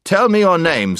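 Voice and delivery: high, loud demand; commanding prince. A narrator's reading. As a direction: order a captive to answer. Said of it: "said the prince".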